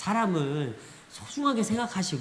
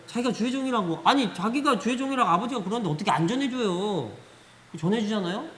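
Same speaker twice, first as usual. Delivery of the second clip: usual speaking voice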